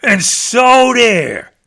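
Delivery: smug bear closing his brag; smug finish. Acted. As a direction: plant it like a final word. Bear ends his boast.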